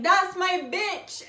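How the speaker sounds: high, loud, slow claiming shout; possessive yell. Pretend. Breaking down the door to claim you. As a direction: bellow it like you own them. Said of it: "they're like".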